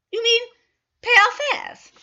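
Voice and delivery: high, loud surprised question; can't believe the offer. Acted. Mrs Carter surprised the consul will pay, high and loud. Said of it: "surprised at offer".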